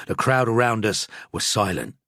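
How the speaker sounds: ordinary delivery, nothing marked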